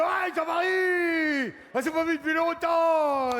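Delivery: loud, high, slow delighted greeting; a long-lost buddy shouting with joy; overjoyed friend. Retold or imitated. Old friend at the toilet door greets Jean-Marie, loud and overjoyed. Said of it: "il fait".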